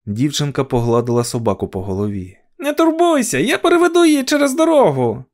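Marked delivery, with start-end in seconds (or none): 2.6-5.2 put-on very high child voice; high, reassuring child's call; little girl calling out. A narrator's reading. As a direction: call across the road in a little girl's voice. Little girl calls to Louisa.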